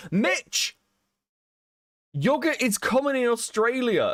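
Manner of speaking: high, excited gotcha; triumphant gloating. Real, not acted. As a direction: crow it, proven right. Triumphant over Mitch.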